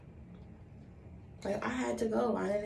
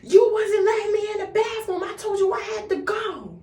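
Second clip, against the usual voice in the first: defensive shout; 7 dB louder than usual. Retold or imitated. Arguing back with mother.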